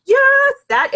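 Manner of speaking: very high, loud joyful YES; cheering her own message; triumphant yes. Real, not acted. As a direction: shout the yes out in celebration.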